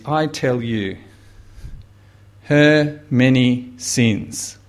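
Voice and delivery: solemn declaration. Acted. Jesus declares forgiveness solemnly.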